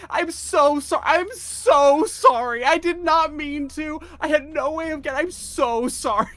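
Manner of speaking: very high, loud panicked pleading; frantic apology. Acted. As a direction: apologise at the top of a squeaky voice. Character frantically apologises to yellers.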